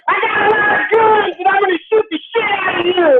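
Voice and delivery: high mocking gun-nut boast; hammed-up threat to the swat team; mock tough guy. Gun owner taunting police, mocking tough voice.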